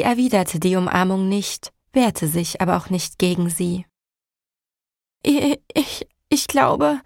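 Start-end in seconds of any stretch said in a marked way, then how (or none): 5.3-7.0 high, shaky and stammering; trembling voice. Acted. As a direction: hold back tears as you stammer. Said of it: "mit bebender Stimme".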